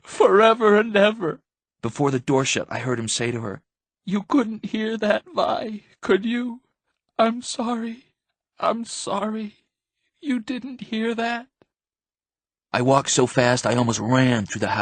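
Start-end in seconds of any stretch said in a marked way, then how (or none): 0.0-1.4 high, wailing cry; anguished, high-pitched sobbing chant. A narrator's reading. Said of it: "He was just crying and saying". 4.0-11.5 high, slow, thin pleading; broken-hearted, high-pitched slow murmur; tearful apology. A narrator's reading. Crying father apologizes to dying daughter.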